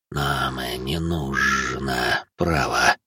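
very slow, low, soft creature speech; ghoul negotiator. A narrator's reading. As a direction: declare it with laboured, dragging words. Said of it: "после паузы заявил переговорщик". (гуль-переговорщик, низко и очень медленно)

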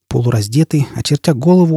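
plain reading voice

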